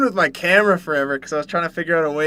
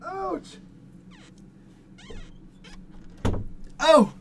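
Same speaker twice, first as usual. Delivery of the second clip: ouch, hot plate; 6 dB quieter, much slower than usual. Real, not acted. Plate too hot to hold.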